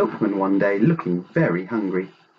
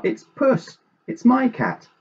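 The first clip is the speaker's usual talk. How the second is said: high-pitched claim of ownership; excited insistence; 14 semitones higher than usual. A narrator's reading. Grandpa claims the found cat as his, excited insistence.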